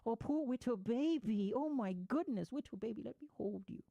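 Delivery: put-on parent cooing over a crying toddler. Parent comforting their sad child, high baby-talk coo. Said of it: "They're like".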